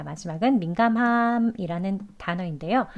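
ordinary talk, nothing marked

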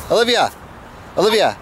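calling a name across the room. Real, not acted. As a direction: call her name, rising high then falling. Calling for daughter.

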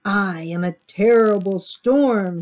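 loud, booming mock yell; story yell. A narrator's reading. Sammy playing a storm in class, yelled. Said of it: "Sammy yelled".

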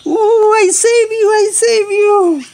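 high, loud and slow with triumph; overjoyed rescuer, voice soaring; excited. Real, not acted. Triumph after saving the bird.

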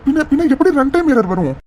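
high, pressing questions; alarmed disbelief. Acted. Surya questions Mitra in shocked disbelief. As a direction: demand answers in shock. Manager hears runtime error.

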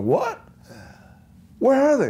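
high, startled what; retold surprise. Telling a friend how he heard of the gig, high excited surprise. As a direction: jump at the news, high and eager. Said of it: "I went".

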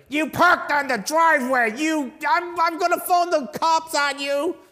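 high, loud, petty complaining neighbor; mock the cranky neighbor threatening to call the cops; grumpy neighbor. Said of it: "you're not the neighbor like".

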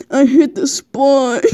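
words broken by laughter, slow; laughing through reading. Real, not acted. Reading a smutty fanfic to a friend, cracking up. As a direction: fight through the giggles to finish.

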